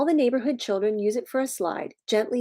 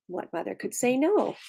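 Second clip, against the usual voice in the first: ordinary delivery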